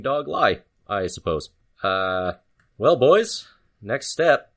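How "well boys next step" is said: higher, louder call to the brothers; rally the boys; gruff brother voice. Acted. Character addresses brothers.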